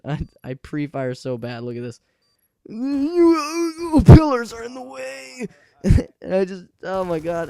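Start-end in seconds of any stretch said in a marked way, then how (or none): 2.7-5.5 loud, high, drawn-out whine; hammed-up crying protest; mock wail. Mock wail while watching gameplay. 5.8-7.5 laughing. Real, not acted. Cracks up after own wail.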